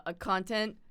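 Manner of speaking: usual speaking voice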